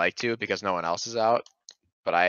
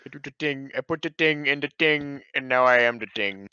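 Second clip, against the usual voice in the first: mock dumb; 6 semitones higher than usual. Mocking the game.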